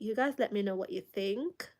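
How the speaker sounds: ordinary delivery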